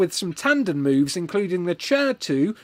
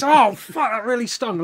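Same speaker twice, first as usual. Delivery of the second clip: mock pained cry; 7 semitones higher, 4 dB louder than usual. Narrator slapped in the face.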